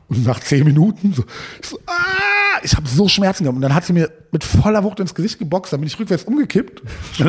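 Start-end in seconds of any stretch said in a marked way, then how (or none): 1.9-2.6 high sharp scream of pain; imitated yelp of agony; mock pain scream. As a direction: shriek as the nails bite. Pain after standing on nails.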